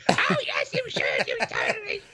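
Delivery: very high, light falsetto of a doting mother; mock falsetto mother; ghost mother voice. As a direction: squeak it out like a sweet old ghost mum. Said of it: "his mom's just sitting there like".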